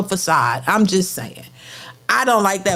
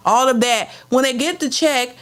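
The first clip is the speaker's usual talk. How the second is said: normal talking voice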